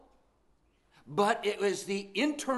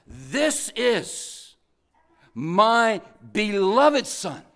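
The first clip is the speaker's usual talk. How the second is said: loud, slowed-down proud declaration; proud father proclaiming; 8 dB louder, much slower than usual. Acted. Preacher voicing God proudly proclaiming his son, loud and slow. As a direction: announce it like a proud father, drawn out. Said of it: "He says".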